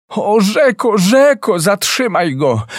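a final desperate cry; one last high plea to the river; last call. A narrator's reading. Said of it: "ostatni raz zawołała Piękna".

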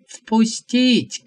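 sharp loud command to let him in; shout. A narrator's reading. Prophet shouting to the guard to let visitor in, loud. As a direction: bark the order at the door. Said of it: "крикнул пророк".

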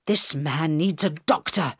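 ordinary delivery, nothing marked

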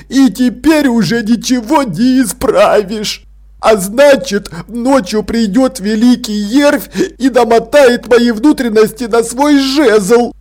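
high, slow tearful wail; whimpering. A narrator's reading. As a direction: whine in despair at the coming punishment. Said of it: "прохныкал Артур".

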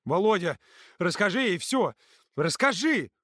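high, loud urgent begging; pleading. A narrator's reading. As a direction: beg desperately for backup. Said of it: "взмолился Юрка".

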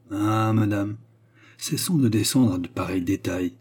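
ordinary delivery

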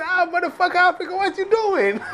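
high, mocking put-on voice of a rude stranger. Stranger on the train jeering at the African, high mocking voice. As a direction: squeal it out like a jeering bully. Man on train insults him.

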